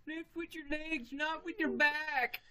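high, slow mock instructor voice; mock safety instructor. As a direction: lecture like a fake safety coach. Mock safety instruction while lifting.

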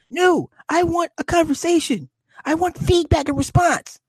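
high mock-girly voice insisting on a conversation; mock demanding wife. Husband mimicking his wife's demand for conversation, high mock voice. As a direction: pitch way up, playing the wife who wants feedback.

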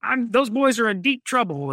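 hammed-up scowling military superior; scolding officer. Officer grumbling about misbehaving flyboys, mock scolding. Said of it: "the scowling and scolding officer of like".

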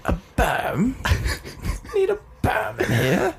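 high, hammed-up English accent; bad English accent. Co-hosts riffing on the room, bad English accent. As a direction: put on a badly faked English voice. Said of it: "that's a bad English accent".

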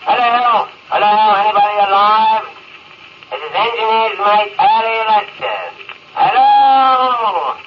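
hailing anyone who might hear, pitched high; desperate radio call, voice up high. Acted. Stranded man calling over radio.

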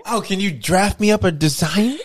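high, slow put-on request; eager customer. Playing a customer asking mum for work, high and eager.

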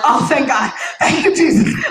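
high, loud thankful burst; relieved joy. Retold or imitated. To God after the breakup, loud relieved thanks.

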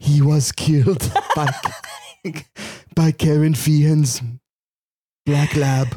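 slow, high, stretched-out storyteller delivery; mock obituary narration. Host narrating his own death by dog, mock solemn. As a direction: intone it grandly and slowly, breaking into a laugh.

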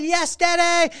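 put-on high, loud child's yes; eager small son's reply; excited little boy. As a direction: answer like a thrilled kid. Son answers eagerly.

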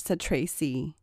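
usual speaking voice

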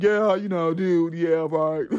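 low, loud dude voice; man voice. Shown as a demonstration. Podcaster showing her voice for all men, put-on man voice. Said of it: "That's my man voice".

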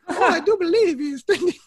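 high falsetto lady voice; squeaky high woman's voice; mistress voice. Retold or imitated. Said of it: "she said to my wife".

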